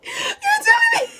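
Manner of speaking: shrieked through laughter, very high and loud; laughing outraged shout. Retold or imitated. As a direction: crack up while yelling it in mock betrayal. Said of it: "I felt betrayed".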